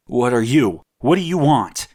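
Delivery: put-on a frightened boy snapping back at the gargoyle; shot back. A narrator's reading. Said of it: "Ethan shot back at him".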